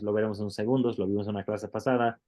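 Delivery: ordinary talk, nothing marked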